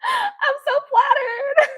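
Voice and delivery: very high, drawn-out wail; overcome with flattery. Real, not acted. Streamer flattered by a troll, drawn-out delighted squeal.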